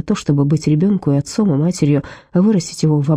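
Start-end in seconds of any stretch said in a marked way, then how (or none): none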